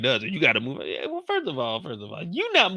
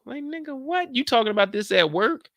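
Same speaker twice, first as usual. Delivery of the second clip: much higher, squeaky what; disbelief; 10 semitones higher than usual. Real, not acted. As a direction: shoot way up in pure disbelief. Incredulous reaction.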